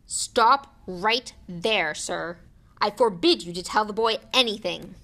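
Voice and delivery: loud order, words punched out; blustering command. A narrator's reading. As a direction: forbid it with loud authority. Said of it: "he commanded".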